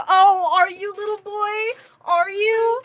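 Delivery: put-on high, teasing baby talk; mocking coo. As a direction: coo at a little boy in a high voice. Teasing coo after a boast.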